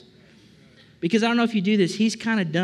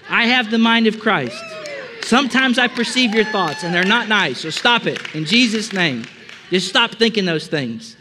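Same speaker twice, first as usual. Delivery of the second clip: mock stern rebuke; 6 semitones higher, 6 dB louder than usual. Preacher scolding the congregation for their thoughts, loud mock rebuke. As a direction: boom it out like a joking reprimand.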